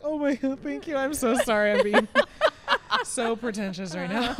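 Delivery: high, loud gush breaking into laughter; laughing mock apology. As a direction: retell an embarrassed apology, laughing through it. Retelling her embarrassed, gushing apology.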